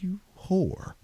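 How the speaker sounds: high, mock-outraged jab; mock insult. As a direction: throw it at her as a joke. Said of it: "I was like".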